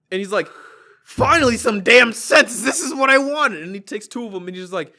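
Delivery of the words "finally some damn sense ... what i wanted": loud, high mock-indignant outburst; hammed-up grumpy triumph; imitated exasperated relief. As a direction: proclaim it as a fed-up old customer.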